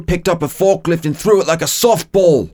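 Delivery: mock astonished retelling, pitched well up; Blonsky. Co-host imitating a rattled soldier recounting the fight, hammed-up. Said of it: "Blonsky is all like".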